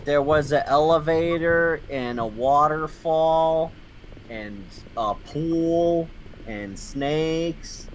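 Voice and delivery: very slow, savoring each place; slow listing. Pretend. Recalling the backyard to a friend, very slowly. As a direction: list them as if dreaming.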